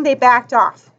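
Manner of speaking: usual speaking voice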